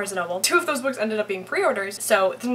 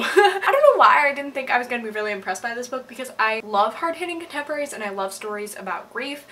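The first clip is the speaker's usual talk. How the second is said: usual speaking voice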